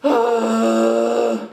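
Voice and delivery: high, breaking voice through tears; crying. Real, not acted. Venting to viewers on camera, breaking down in real tears. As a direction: sob out how exhausted you are. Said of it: "I'm crying because".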